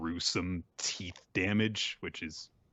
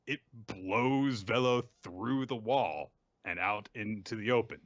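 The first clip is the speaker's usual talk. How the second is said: normal talking voice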